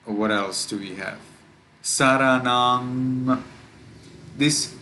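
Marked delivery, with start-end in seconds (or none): 1.8-3.4 Saranam drawn out slowly, ending on a long humming ng; sustained final ng. Shown as a demonstration. Teacher showing students the important ng sound, final ng held in Saranam. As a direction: say Saranam slowly and hold the closing ng. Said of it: "pronounce it well".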